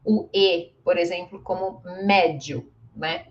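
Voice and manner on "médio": "médio" with a strong open eh; teacher's demo of open E in "médio". Shown as a demonstration. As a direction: say "médio" as MEH-dyoo, stressed and drawn out. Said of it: "Então, som aberto".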